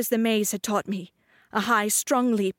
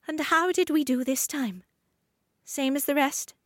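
much higher, sleepy spider voice; spider Sleeve; 6 semitones higher than usual. Acted. Spider Sleeve asking the girl, much higher curious voice. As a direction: ask it small and curious.

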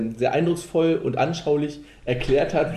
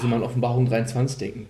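ordinary delivery

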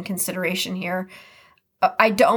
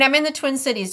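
ordinary talk, nothing marked

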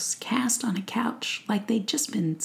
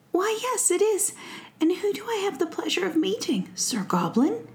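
higher, courteous prince voice; polite prince; 11 semitones higher than usual. Acted. Prince politely asking the goblin's name. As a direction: ask politely, like a gracious prince. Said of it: "asked the prince politely".

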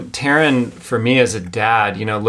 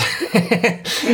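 light laugh; 12 semitones higher than usual. Real, not acted. Laughs mid-sentence on podcast.

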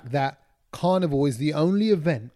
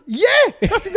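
high, loud shocked outburst; 7 dB louder than usual. Retold or imitated. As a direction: blurt it out in shock. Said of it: "in my shocked voice".

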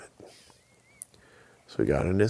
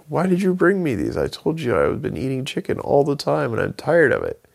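much higher, louder fed-up Bigfoot; annoyed complaint; 12 semitones higher, 4 dB louder than usual. Acted. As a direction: complain loudly about the eggs.

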